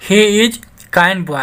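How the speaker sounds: very slow, word by word; slow dictation; slow reading. Shown as a demonstration. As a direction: read the example for learners. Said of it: "teacher reads example sentence".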